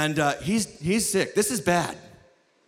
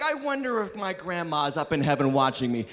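lower, sinking mock-wistful wondering; mock musing; 6 semitones lower, 4 dB quieter than usual. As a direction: mimic someone idly wondering. Mimicking wistful listeners' musing.

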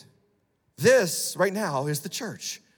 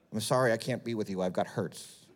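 mock self-pity; 8 semitones lower, 6 dB quieter than usual.

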